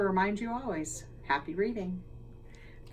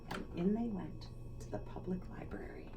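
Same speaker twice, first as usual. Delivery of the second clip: whisper; 10 dB quieter, faster than usual. A narrator's reading. Whispered narration line.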